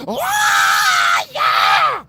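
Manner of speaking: high shrieked ah yeah, loud; imitated rock scream. Mimicking the singer, high shrieked ah yeah. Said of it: "He goes".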